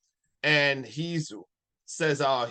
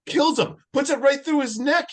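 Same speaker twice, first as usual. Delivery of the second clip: excited; 11 semitones higher, 6 dB louder than usual. A narrator's reading. Excited retelling of a kill.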